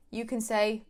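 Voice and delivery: normal talking voice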